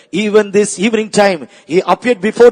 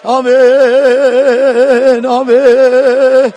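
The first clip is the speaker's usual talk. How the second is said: praying in tongues; 4 semitones higher, 3 dB louder than usual. Acted. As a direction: pour it out as ecstatic prayer. Preacher prays in tongues, drawn-out amens.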